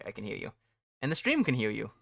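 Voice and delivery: usual speaking voice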